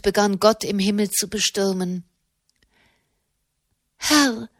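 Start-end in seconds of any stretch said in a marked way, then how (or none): 4.0-4.5 pleading. A narrator's reading. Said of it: "flehte sie".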